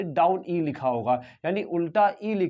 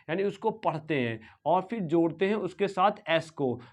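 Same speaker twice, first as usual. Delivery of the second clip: normal talking voice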